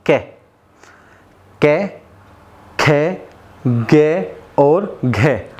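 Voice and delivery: each consonant drawn out slowly; slow syllable drill. Shown as a demonstration. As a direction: sound out कै, खे, गे, घे one by one from the throat. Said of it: "अब बोलना है हमें". (गले से धीरे धीरे कै, खे, गे, घे बोलना)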